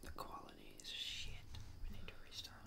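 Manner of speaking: hushed whisper to the co-host. Real, not acted. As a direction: whisper so the stream can't hear.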